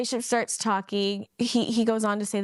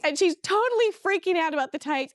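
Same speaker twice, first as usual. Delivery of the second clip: ordinary talk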